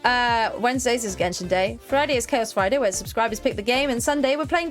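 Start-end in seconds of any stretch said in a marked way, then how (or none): none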